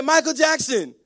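put-on high, loud kid voice; young son's joke. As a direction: imitate a little boy announcing the name. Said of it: "He said".

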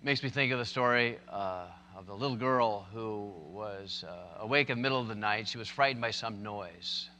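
ordinary talk

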